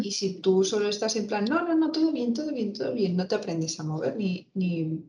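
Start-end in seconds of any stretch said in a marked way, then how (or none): 1.4-3.1 fake cheerful. Mocking forced positivity.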